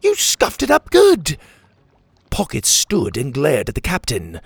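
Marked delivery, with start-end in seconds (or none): 0.0-1.4 upset outcry. Acted. Character upset over the scuffed shell, high dismayed outcry. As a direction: wail over the damage.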